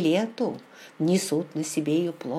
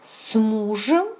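higher, drawn-out shocked question; startled exclamation; 4 semitones higher, 4 dB louder, slower than usual. A narrator's reading. Heroine reacts to Raymond mentioning her husband, startled exclamation. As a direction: exclaim it slowly in alarm, rising sharply. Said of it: "воскликнула я и побледнела".

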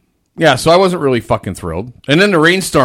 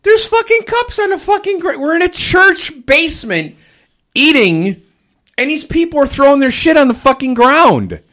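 outraged rant; 13 semitones higher, 4 dB louder than usual. Retold or imitated. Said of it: "I'm like".

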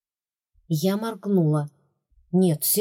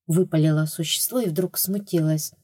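ordinary delivery

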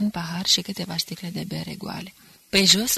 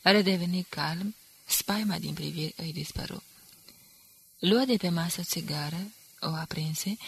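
ordinary delivery, nothing marked

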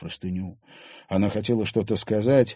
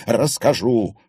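ordinary delivery, nothing marked

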